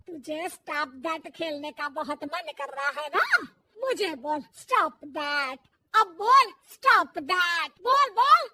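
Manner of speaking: mother-in-law daring daughter-in-law to say it; angry taunt. Acted.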